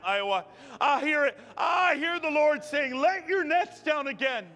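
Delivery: high, impassioned exhortation; fervent preaching. Real, not acted. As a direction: preach it urgently, voicing the pleas and answers.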